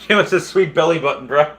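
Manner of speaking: laughing through the words; chuckling at a chat joke. Real, not acted. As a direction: giggle it out. Laughing at a comment.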